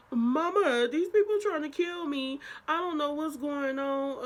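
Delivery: high, loud whiny plea; crybaby plea. Retold or imitated. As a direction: whine to mama like a crybaby. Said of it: "crybaby ass".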